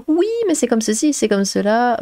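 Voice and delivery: high-pitched mimicking of fault-finders; mock nitpicking. Mimics nitpickers listing bad points.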